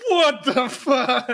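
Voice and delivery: slow, high, stunned disbelief; incredulous what the fuck. Retold or imitated. Podcaster reacting to Van Helsing's line, slow stunned disbelief. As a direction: drag it out, aghast. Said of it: "It's like".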